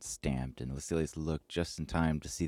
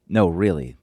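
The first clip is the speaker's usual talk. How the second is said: pushed out louder, insisting; 2 semitones higher, 12 dB louder than usual. A narrator's reading. Angry friend pressing Lucilius, louder and insistent. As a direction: double down hard. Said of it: "the man doubled down".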